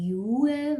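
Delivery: یوه begins with a y-glide, "yoo"; English letter name. Shown as a demonstration. Teacher contrasting English for learners, یوه with a y-glide. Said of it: "این حرف تو انگلیسی".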